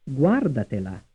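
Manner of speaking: "Guardatela" with the beat on GUAR; first-syllable stress. Shown as a demonstration. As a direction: hit the opening "Guar" of "Guardatela", let the rest fall. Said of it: "stressed on the first syllable".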